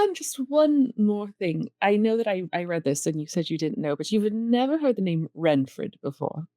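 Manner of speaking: ordinary delivery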